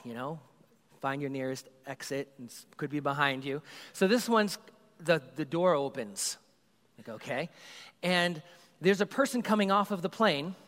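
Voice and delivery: usual speaking voice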